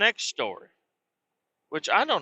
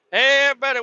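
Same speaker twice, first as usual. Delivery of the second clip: put-on high, loud drunk calling out; drunk wake-up call; 9 semitones higher, 3 dB louder, faster than usual. Drunk at the campsite waking his family, loud high calling out. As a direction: rouse the whole campsite.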